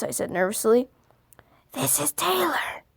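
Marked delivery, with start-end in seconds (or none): 1.7-2.8 slower, higher, softer stranger voice; a lightly put-on eerie caller; creepy stranger. Stranger on the phone answers, slow and eerie. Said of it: "the voice replied".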